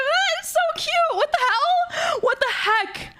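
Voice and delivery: very high, drawn-out gushing squeal; real high-pitched burst of delight. Real, not acted. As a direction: squeal over something adorable. Delighted reaction to birthday messages.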